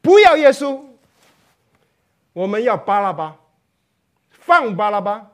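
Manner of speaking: loud mob demand; a crowd calling for Barabbas to be freed; crowd shouting. Acted. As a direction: yell it like the crowd. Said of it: "喊着说啊".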